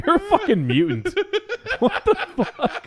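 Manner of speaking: very high, helpless laughing squeal; laughing to tears. Real, not acted. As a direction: squeal it out, nearly crying with laughter. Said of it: "Is he crying?".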